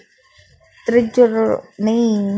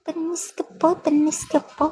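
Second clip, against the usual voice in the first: high, soft, sing-song catchphrase; high cutesy voice; 6 semitones higher, 6 dB quieter than usual. Pretend. Streamer repeats her catchphrase to viewers in high soft cutesy voice. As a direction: coo it like a sweet little kid.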